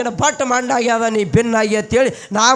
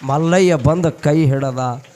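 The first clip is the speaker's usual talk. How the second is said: ಮಲ್ಲಯ್ಯ ಬಂದು ಕೈ ಹಿಡದ said lower and gentler; tender lower echo; 5 semitones lower than usual. A narrator's reading. Repeated rescue line.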